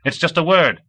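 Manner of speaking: put-on high-pitched, emphatic kid voice; impatient. A narrator's reading. Kid explaining fun words to Komov, high and impatient. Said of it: "the kid said impatiently".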